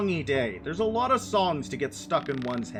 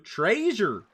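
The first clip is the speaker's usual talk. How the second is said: lightly voiced Fred exclaiming; Fred impression; 4 semitones higher than usual. Streamer trying Fred's line again, mild exclamation. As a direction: call it out like Fred. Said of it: "How does Fred say it in Scooby-Doo?".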